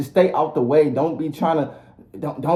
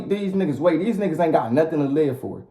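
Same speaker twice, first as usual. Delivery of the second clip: normal talking voice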